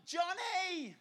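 high, far-carrying mock yell; worried calling out. Shepherd searching for his lost sheep in a storm, worried yell. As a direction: holler the name into the distance. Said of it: "he's yelling out".

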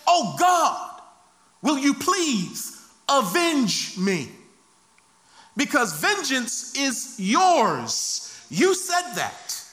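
high, slow, drawn-out plea; crying out to God. Acted. Slain man's blood begging God for vengeance, crying out. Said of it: "does the blood cry out and say".

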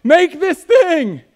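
high, loud rallying cry; cheering. Acted. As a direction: cheer him on like a roaring crowd. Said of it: "cheering on the Creator, saying".

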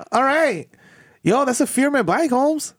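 high, slow comedian impression; Gabriel Iglesias. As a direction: stretch it out in a high mock comic voice. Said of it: "He be like".

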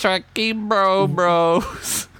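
very slow, high bro drawl. Acted. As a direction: stretch it like a frat bro. Drawn-out bro voice.

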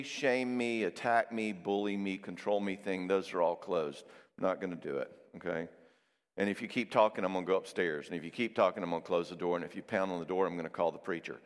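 low, calm, firm boundary; loving limit. Acted. Spouse warning someone who keeps bullying, calmly firm. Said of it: "Now, that's a loving limit".

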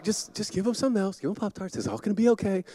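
the wife's hushed calm voice, a touch imitated; soothing wife. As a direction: settle him down gently. Wife calmly soothing him, quoted.

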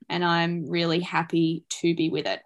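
usual speaking voice

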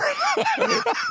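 a sudden high laugh of disbelief; high burst of laughter. Real, not acted. Actor sees his own line in the script, laughs high. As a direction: laugh out in surprise.

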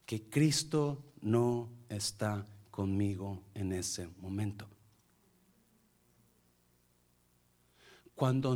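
soft, low sermon voice; hushed, solemn preaching. Real, not acted. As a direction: let it sink in, quietly and with pauses.

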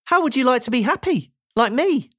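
high, quick, cheery character voice; chirpy, upbeat invitation; Mister Happy. A narrator's reading. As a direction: offer it eagerly, pitched well up.

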